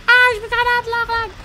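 very high, loud shriek; panicked shrieking. Real, not acted. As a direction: scream it in desperate game panic. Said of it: "Hat man an deinen männlichen Schreien gehört". (hohes, panisches Kreischen)

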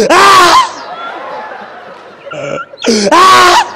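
hammed-up yelp of pain; pained cry. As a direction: cry out as if just slapped. Comic cries after slap.

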